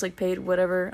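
ordinary talk, nothing marked

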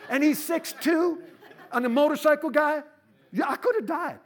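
loud, high-pitched preacher's disbelief; incredulous exclaiming. Real, not acted. Preacher telling the crowd he could have died, loud incredulous exclaiming. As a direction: shout it to the crowd, amazed at the risk. Preacher reliving a dangerous moment.

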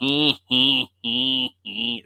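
imitated creepy Renfield laugh; drawn-out, creepy mock giggle. Favorite Dracula character's laugh, imitated as a creepy giggle. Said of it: "he was very that creepy".

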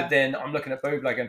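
ordinary delivery

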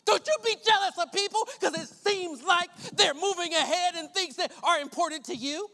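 loud, punchy preacher's delivery; emphatic pulpit exhortation; forceful preaching. Real, not acted. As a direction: drive it home like a preacher.

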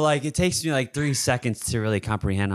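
ordinary delivery

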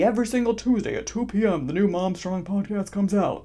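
lightly voiced rigid podcast promo; a hint of a strict show announcer; mock podcast announcement. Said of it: "That's not what I'm doing".

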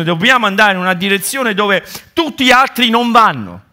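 più acuto e più forte, esortazione appassionata; predica infervorata. Acted. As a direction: spingi i fedeli al coraggio. Preaching at full force. (esortazione ardente, voce alta e forte)

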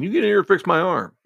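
yelling after him. Retold or imitated. Patient yelling after doctor.

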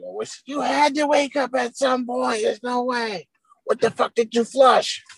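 high imitated mother voice; scolding mom. Mom interrogating son next morning, high scolding. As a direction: scold in a mock mom falsetto.

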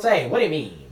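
put-on old-timey movie voice.

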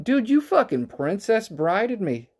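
high-pitched, drawn-out mock outrage; indignant accusation. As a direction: call him out in a high, slow protest. Acted accusation of pool opponent.

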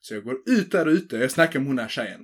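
usual speaking voice

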